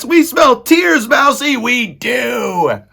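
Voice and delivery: very high, slow, loud taunt; shouting rats. A narrator's reading. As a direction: squeal gloatingly as a rat mob. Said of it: "shouted the rats".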